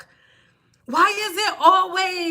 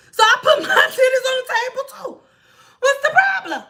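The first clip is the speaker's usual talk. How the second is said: laughing shout; 8 semitones higher, 4 dB louder than usual. Real, not acted. Laughing retort.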